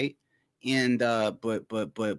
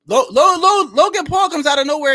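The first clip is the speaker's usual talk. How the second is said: high, loud hammed-up hype intro; mock Logan Paul announcer; 13 semitones higher, 11 dB louder than usual. Mocking Logan Paul's entrance, high and loud hype. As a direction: belt his name like a ring announcer. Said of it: "Logan Paul was like".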